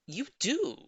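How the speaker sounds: high, loud you do; surprised cry. A narrator's reading. Old lady surprised by neighbour's claim, high cry. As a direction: gasp it out. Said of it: "she cried".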